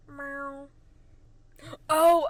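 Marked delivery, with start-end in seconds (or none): 0.1-0.7 short, soft, level meow; Gary. Acted. Host showing the Gary meow, soft imitation. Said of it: "the Gary meow".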